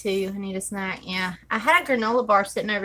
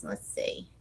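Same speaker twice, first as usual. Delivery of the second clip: whispered; 12 semitones lower, 9 dB quieter than usual. Real, not acted. Muttering to herself.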